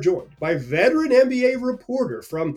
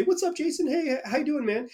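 high, chummy mock greeting; imitating a buddy greeting; 10 semitones higher than usual. Host imitating Howard greeting Jason, high chummy hello. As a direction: toss off a bright, friendly hello. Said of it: "you say".

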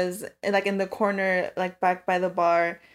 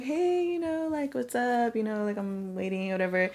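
tentative greeting; 8 dB quieter than usual. Retold or imitated. Retold timid approach to date.

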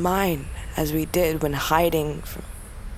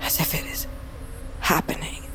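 whispering; 5 dB quieter than usual. Acted. Reliving sister's death.

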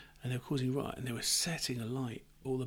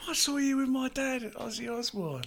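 put-on high-pitched Irish boy's voice; high Irish kid; 11 semitones higher than usual. Kid recognising him from a gig, high Irish-accented voice. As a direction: pipe up as a young Irish lad. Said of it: "in an Irish accent".

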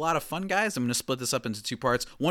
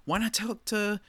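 ordinary delivery

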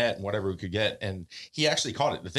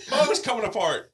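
ordinary delivery

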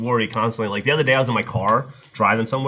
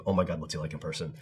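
hushed awe; 6 semitones lower, 10 dB quieter than usual. Retold or imitated. Retold awed fan question.

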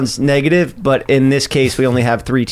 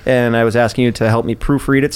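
normal talking voice

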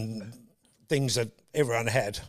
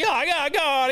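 high excited commentator exclamation; loud, high, overexcited cricket caller; Bill Lawry; 14 semitones higher, 4 dB louder, slower than usual. Retold or imitated. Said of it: "Bill Lawry".

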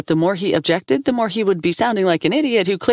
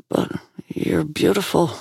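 whispered. Acted. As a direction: whisper it tenderly. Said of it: "he whispered".

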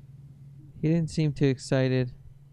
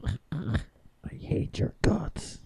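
whispered; 5 dB quieter, much slower than usual. Pretend. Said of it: "deadpan repeat of earlier line".

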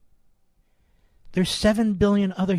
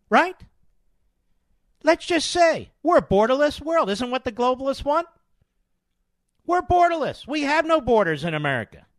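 sarcastic; 8 semitones higher than usual. Retold or imitated. Mocking open-border globalists.

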